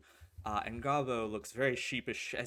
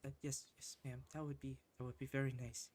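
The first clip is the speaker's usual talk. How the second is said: shy thanks; 10 dB quieter, faster than usual. Acted. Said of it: "he says".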